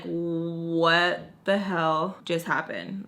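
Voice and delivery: very slow, stretched-out disbelief; stunned disbelief. Retold or imitated. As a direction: drag out each word, dumbfounded. Everyone stunned after the blow-up.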